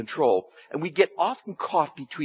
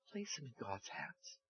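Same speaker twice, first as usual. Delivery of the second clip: whispered instruction; 4 semitones lower, 24 dB quieter than usual. Real, not acted. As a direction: breathe it out, barely voiced.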